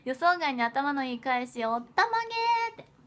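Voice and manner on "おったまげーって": high comic exclamation; mock astonishment. Idol reading a fan's silly reaction word, amazed. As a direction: ham up the surprise.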